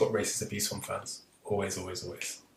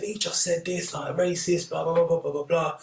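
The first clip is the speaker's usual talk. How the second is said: retold angry protest; 6 semitones higher than usual. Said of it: "we're going mad at the ref saying".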